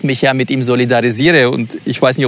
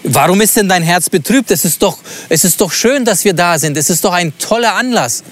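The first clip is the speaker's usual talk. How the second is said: higher, irritated, losing patience; annoyed, impatient; 6 semitones higher than usual. Shown as a demonstration. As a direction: push the questions out in irritation. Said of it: "ist er genervt? Wird er ungeduldig?".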